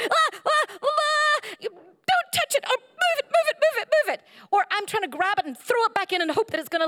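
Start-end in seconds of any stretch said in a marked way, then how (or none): 0.0-4.2 high, squealing panic at a flapping fish; panicked squeals. Retold or imitated. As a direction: shriek in mock panic as the fish flops. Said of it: "you go".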